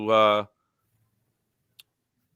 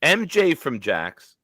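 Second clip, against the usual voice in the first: announcer hype; 7 semitones higher, 4 dB louder than usual. Acted. Host hailing new channel members, hyped announcer voice. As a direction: belt out each name like a big-show introduction.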